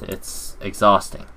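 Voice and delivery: ordinary talk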